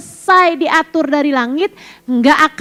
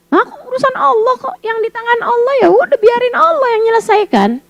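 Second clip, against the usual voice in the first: light carefree retort; 8 semitones higher than usual. Retold or imitated. Voicing carefree reply.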